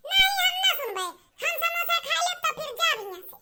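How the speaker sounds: very high, loud laughing shout; defiant shout. Acted. Child refusing the elder, shouting high with a laugh. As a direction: yell back defiantly, half laughing.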